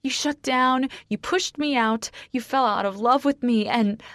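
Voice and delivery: high, heated list of blame; distraught accusation. A narrator's reading. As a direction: hurl each accusation at him. Screeched accusation continues until cut off.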